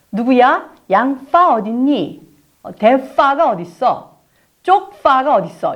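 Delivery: slow 양파, 대파, 쪽파 with the 파 stressed; practising the f sound. Shown as a demonstration. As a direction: drill the 파 syllables slowly. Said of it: "이렇게 연습하는 거죠".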